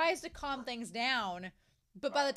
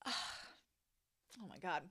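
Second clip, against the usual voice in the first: weary sigh; 5 semitones lower, 9 dB quieter, much slower than usual. Real, not acted. Exasperated at the plot.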